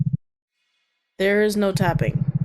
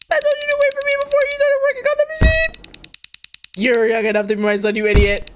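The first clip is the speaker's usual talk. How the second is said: fast, high-pitched acted outburst; angry scolding; 4 dB louder, faster than usual. Older worker telling off the young one, angry scolding. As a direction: lay into the kid, ending loud. Acts a character scolding injured kid.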